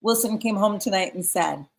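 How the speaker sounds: ordinary talk, nothing marked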